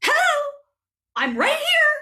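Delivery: high, insistent Hello! I'm right here!; indignant call. Acted. Ignored leader shouting to China and Canada to be noticed. Ignored character demanding attention.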